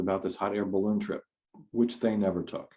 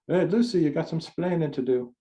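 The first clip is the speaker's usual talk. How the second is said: bad Cuban accent; 7 semitones higher than usual. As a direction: playfully fake a Cuban accent on the line. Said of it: "Sorry for the bad accent".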